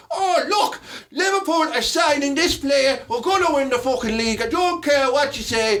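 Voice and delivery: Irish pundit ranting, slow and emphatic. Retold or imitated. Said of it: "why is that acceptable to do an Irish voice".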